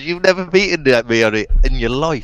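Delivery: high, loud teasing through laughter; laughing mockery. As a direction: scoff at him with a laugh. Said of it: "And he's laughing at me".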